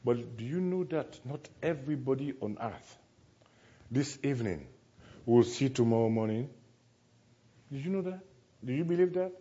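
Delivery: normal talking voice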